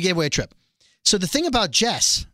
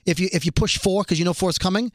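ordinary talk

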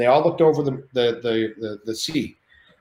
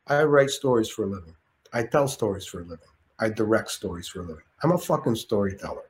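lightly put-on De Niro, growing softer; 2 semitones lower, 4 dB quieter than usual. De Niro explaining his craft to Shane, lightly put-on, fading softer. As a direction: lay it down as De Niro. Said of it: "He goes".

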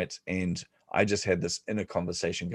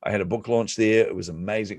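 normal talking voice

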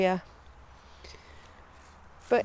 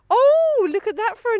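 high, loud amused gasp of surprise; a delighted squeal at a silly name; surprised oh; 10 semitones higher, 6 dB louder than usual. Real, not acted. Marvelling at the long name, surprised oh.

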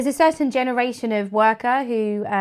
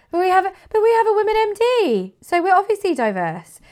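much higher, louder mock spokesperson; silly put-on voice; 11 semitones higher, 4 dB louder than usual. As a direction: parody a defensive company in a high silly voice. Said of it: "I don't know why I did that voice".

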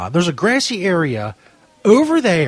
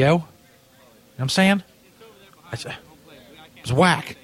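ordinary delivery

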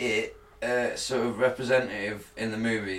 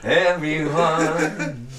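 laughing; 7 semitones higher, 4 dB louder, much slower than usual. Pretend. Jokey sign-off while laughing.

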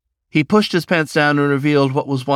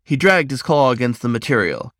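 usual speaking voice

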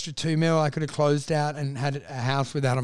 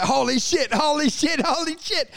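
rising alarm; 9 semitones higher than usual. Retold or imitated. Watching the shoe land.

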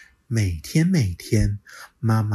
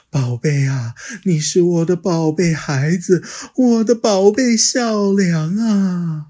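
higher, louder fond reassurance, slowing; comforting mother; 9 semitones higher, 7 dB louder than usual. Acted. Mother comforting her crying little one, fond and reassuring. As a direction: hug him with your voice.

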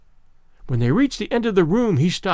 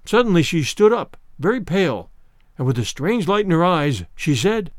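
plain reading voice

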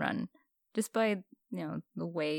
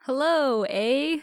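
hammed-up Canadian with a higher, louder eh on every line; mock Canadian accent; 6 semitones higher, 7 dB louder than usual. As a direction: play up the Canadian eh, teasing the host. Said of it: "Can you tell I'm Canadian, eh?".

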